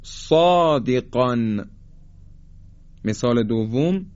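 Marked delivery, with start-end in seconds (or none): 0.0-1.7 slow, heavy صادقان with drawn-out long vowel; tajweed demo. Shown as a demonstration. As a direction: recite صادقان slowly for repeating, full dark ص. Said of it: "بعد از بنده تکرار کنید".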